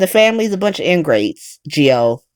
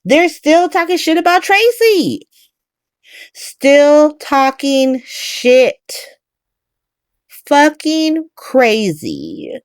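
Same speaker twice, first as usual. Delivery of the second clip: drawn-out disbelief; 7 semitones higher, slower than usual. Real, not acted. Outraged recap of the plot.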